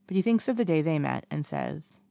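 plain reading voice

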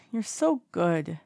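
slow sleepy drawl; fond drunken compliment; sleepy slurred fondness; drowsy tipsy praise. A narrator's reading.

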